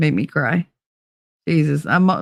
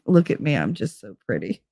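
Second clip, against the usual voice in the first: vain cat voice; 4 semitones lower, 6 dB quieter than usual. Retold or imitated. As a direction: lower and much softer, a smug preening cat. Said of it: "they'd look at you like".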